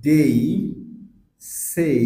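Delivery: দেই, সেই said loud, gliding e into i; diphthong example words. Shown as a demonstration. Teacher giving example words, loud and clear. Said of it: "যেরকম".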